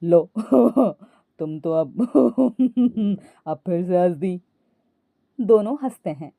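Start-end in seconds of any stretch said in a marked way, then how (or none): 0.0-4.4 husband chuckling in a low voice; low male voice broken by chuckles; laughing. A narrator's reading. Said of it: "Ajay भी हंसकर बोला". (हंसकर, नीची आवाज़ में)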